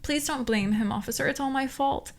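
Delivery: high whiny apology; a hint of a whiny partner pleading; whined. A narrator's reading. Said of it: "the G Z whined".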